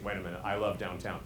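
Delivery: low, soft monotone; low pitch. Acted. Two-pitch presenter switching to downtown, low monotone. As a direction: drop abruptly to the bottom note. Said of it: "just on two pitches".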